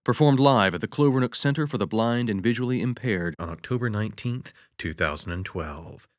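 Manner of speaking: usual speaking voice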